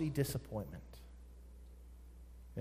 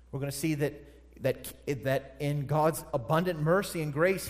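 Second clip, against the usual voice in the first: usual speaking voice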